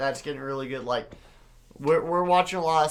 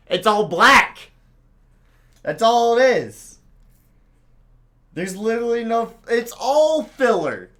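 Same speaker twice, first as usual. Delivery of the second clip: loud, high, exasperated yelling; dragged-out, worked-up outburst at raised pitch; triggered rant; 7 semitones higher, 8 dB louder than usual. Real, not acted. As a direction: rant at full voice, thoroughly fed up. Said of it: "I'm triggered right now too".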